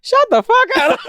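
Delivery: very high starstruck squeal. Retold or imitated. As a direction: squeal it in total disbelief.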